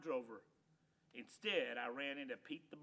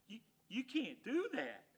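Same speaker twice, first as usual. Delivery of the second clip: shocked stammer; 7 semitones higher, 5 dB louder than usual. Acted.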